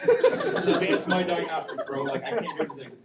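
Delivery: laughing through the words, loud and slow; real laughter breaking into the line. Real, not acted. Laughing at own tuning fork.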